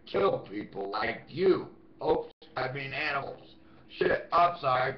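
fake-apologize with a sneer; mock apology. Sarcastic backpedal aimed at the stalker, mock apology. Mock correction after threat.

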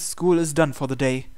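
normal talking voice

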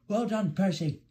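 high-pitched cheering; driver's mild shout. A narrator's reading. Driver cheers Percy on mid-race, mild shout. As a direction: praise him high, without full volume. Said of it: "shouted the driver".

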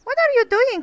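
sharp, high and loud; mock scolding. Player scolding villagers for bothering the dog, sharp and loud. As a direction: scold them like naughty kids.

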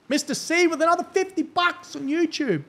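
loud, very high donation cheer; high-pitched hype announcement; excited shout-out. Real, not acted. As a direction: cry it out high for the big donor.

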